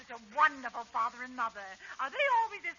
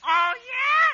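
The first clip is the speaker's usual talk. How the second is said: put-on defiant kid shout; 10 semitones higher, 11 dB louder than usual. Sebastian defies Abbott.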